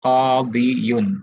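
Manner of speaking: कादियुन drawn out slowly as qaa-di-yun; underlying full form. Shown as a demonstration. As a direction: spell out every syllable of कादियुन. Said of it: "जो असल में कादियुन था". (कादियुन को धीरे-धीरे, पूरा खोलकर)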